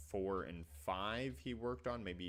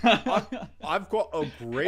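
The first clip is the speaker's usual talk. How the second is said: loud, high, hammed-up British accent; put-on British accent; 10 semitones higher, 16 dB louder than usual. Teasing the British co-host with a fake accent, hammed-up British accent. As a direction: boast in a mock British accent, half laughing. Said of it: "that shite one that you guys put on".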